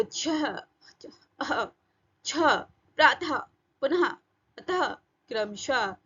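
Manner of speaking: छह, प्रातः, पुनः each ending in a short puff of h; visarga half-h. Shown as a demonstration. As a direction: cut प्रातः, अतः off on a half "ha", word by word. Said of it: "इसका उच्चारण आधे हा जैसा".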